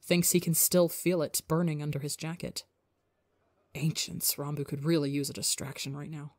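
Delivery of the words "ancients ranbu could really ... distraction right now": usual speaking voice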